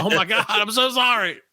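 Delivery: hammed-up dismay; mock horrified apology. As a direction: blurt it in horror. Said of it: "I'm like".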